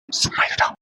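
hushed whisper to a friend nearby. Acted. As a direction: whisper it so the phone won't hear. Hushing friend during phone call.

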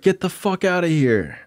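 high, sharp shout at her to get out; retold scream. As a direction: yell it out in a sudden high burst. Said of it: "I, like, screamed".

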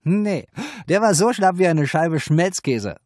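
high, loud mocking madam voice; madam scoffing about a limp client. A narrator's reading. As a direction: sneer at him as the brothel madam.

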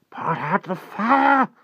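high, loud, drawn-out urgent order; hoarse and urgent. A narrator's reading. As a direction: urge it hoarsely, stretching the words. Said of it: "said a hoarse voice in his ear urgently".

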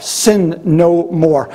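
very slow, each word stretched; drawn out. Acted. Preacher repeating Jesus' command to the congregation, slow and weighty.

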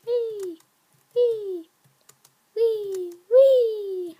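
high whee sliding down; playful squeals. Pretend. Gamer bouncing from jump to jump, playful high squeals. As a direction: squeal lightly with every hop.

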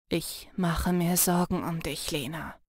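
low, soft and tender; bittersweet. Acted. Jannis telling Lena he worries about her, soft and bittersweet. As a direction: confide the worry gently. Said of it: "in der gleichen bittersüßen Stimmlage".

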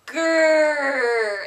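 shouted playful bear growl; loud growl. Pretend. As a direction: roar it out like a bear. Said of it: "A little bit louder".

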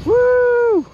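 a loud, high whoop of delight; excited whoop. Real, not acted. Pilot cheering his plane's pass, a loud high whoop. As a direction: cheer the jet as it screams past.

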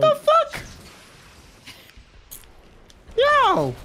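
high shocked yell. Real, not acted. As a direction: blurt it out in shock. Shocked outburst.

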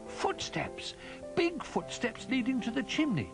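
very high, thrilled little voice; little bear bursting with discovery; excited cry. A narrator's reading. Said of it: "gave a cry of excitement".

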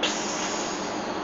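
quiet hissing psst; steam hiss. Acted. Sweat turning to steam.

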